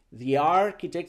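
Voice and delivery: "the architect" as thee-yarchitect; diphthong link. Shown as a demonstration. Teacher shows the sound created, linked thee. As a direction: glide "the" into "architect" on an ee. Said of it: "se crea un diptongo".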